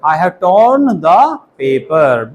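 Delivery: very slow, word by word, torn leaned on; slow dictation. Shown as a demonstration. Teacher to class, stretching the sentence out slowly. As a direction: drill it slowly so children can follow. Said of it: "क्या बोलूंगा".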